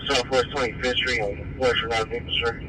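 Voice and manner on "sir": ordinary talk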